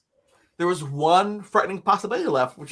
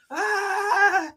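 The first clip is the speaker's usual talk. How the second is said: very high, comic long scream; hammed-up squeal of terror; mock scream. Mocking his own bravery to the host, comic scream. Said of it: "So very bravely, I screamed".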